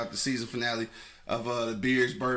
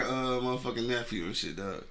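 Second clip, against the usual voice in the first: ordinary talk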